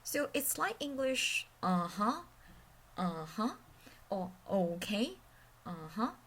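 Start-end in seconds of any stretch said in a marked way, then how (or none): 1.6-3.5 UH-HUH dipping low, then rising; third tone. Shown as a demonstration. Teacher shows tone three with English, dipping. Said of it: "it falls from middle to low first". 4.5-6.1 OKAY and UH-HUH low, then rising; third tone. Shown as a demonstration. Teacher shows tone three with English, dipping. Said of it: "then it rises from low to high".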